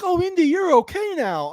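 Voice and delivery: high, far-off put-on voice for Sala's cheery relief; mimicking Sala. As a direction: chirp it up high like a relieved buddy. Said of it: "Sala comes back and he's like".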